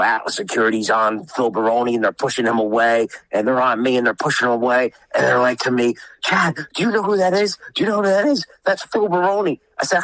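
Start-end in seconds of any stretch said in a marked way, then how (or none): 6.2-9.6 high-pitched, urgent retold warning; imitated alarmed warning. Retold guards warning him urgently.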